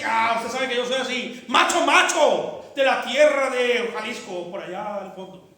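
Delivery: loud, high, swaggering macho boast; macho husband. Acted. Husband bossing his wife, loud swaggering macho. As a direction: puff up like a Jalisco macho.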